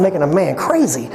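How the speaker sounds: usual speaking voice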